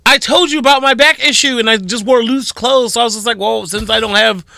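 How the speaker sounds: high-pitched, worked-up justification; high, indignant self-defence; defensive explaining. Real, not acted. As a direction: explain yourself insistently, pitched well up. Defending herself over missing underwear.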